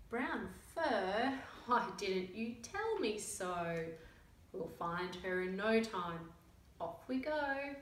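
lively picture-book read-aloud with character voices; reading to children. A narrator's reading. Reading a picture book to young children, lively storytelling. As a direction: tell the story to little listeners.

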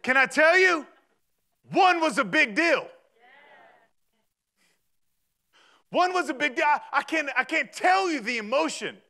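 high, loud preacher's cadence with punched phrases; full-force sermon climax, driving hard; impassioned preaching. Real, not acted. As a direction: stir the congregation up with rising fervor.

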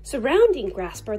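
ordinary delivery

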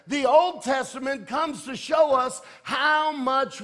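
loud, high, ringing sermon delivery; full-force preaching. Real, not acted. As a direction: drive each phrase home to the congregation.

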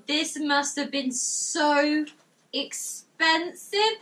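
ordinary talk

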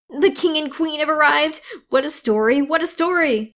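excited. Acted. Excited fairies chattering about royalty.

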